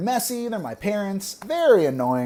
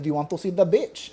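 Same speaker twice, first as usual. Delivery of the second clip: put-on mom's Filipino accent, beach sounding like "bitch"; thick Filipino accent. Mom repeating her question to her son, thick Filipino accent. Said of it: "she has a really thick accent".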